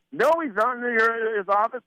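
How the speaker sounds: mock-impatient, high and insistent; irate boss. Boss confronts employee in his office, high mock exasperation. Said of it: "he's storming down the hall".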